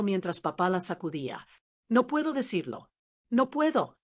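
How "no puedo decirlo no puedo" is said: crying out. A narrator's reading. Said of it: "gritaba ella entre lágrimas".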